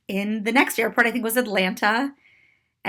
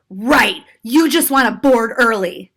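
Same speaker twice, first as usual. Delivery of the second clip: loud, scoffing mock yell; imitated yell; 6 dB louder, slower than usual. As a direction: throw it back as a loud accusation. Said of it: "and yelled".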